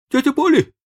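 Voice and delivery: very high, loud breathless call; shouting out of breath. A narrator's reading. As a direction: yell for her, panting. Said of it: "закричал он, запыхавшись".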